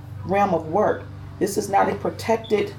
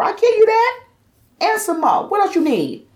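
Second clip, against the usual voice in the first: much higher, louder sassy brag; high, loud, cocky challenge; sassy boast; 5 dB louder than usual. Real, not acted. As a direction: toss it off with pride. Proudly boasting of her care.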